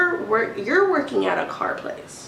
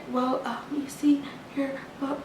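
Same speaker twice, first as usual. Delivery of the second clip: soft, higher mock whisper; hushed faltering clerk voice; 7 semitones higher, 7 dB quieter than usual. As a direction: imitate her timid fumbling. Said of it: "she's still whispering".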